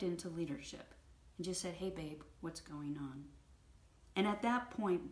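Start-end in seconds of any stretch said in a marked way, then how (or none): 1.7-3.2 soft, low, caring check-in; gentle, non-attacking. Retold or imitated. Husband stepping in instead of fighting back, gently. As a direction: ask quietly, holding space for them. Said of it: "Instead of attacking her".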